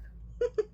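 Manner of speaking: high breathy witch cackle. Acted. Witch laughing at the book in her hair, a high cackle.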